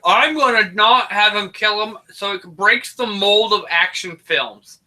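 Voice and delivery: pompous director voice, high then slowed; director impression. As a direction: play a self-serious film director. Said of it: "here's my thinking if I'm the director".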